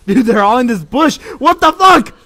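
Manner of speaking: real high, loud excited shout; genuine excitement. Real, not acted. Streamer spots a whole team in the bush, genuinely excited. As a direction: shout it out, pitch leaping up. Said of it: "that was genuine excitement".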